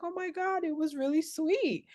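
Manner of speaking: high, gushing delight. Retold or imitated. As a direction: swoon over something sweet. Gushing reaction to a sweet gift.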